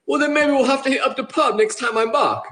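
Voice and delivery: hammed-up chummy British; pretend British accent. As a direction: really sell the fake British accent. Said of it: "I was really trying to sell it".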